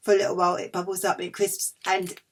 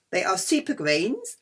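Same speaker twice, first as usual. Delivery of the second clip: normal talking voice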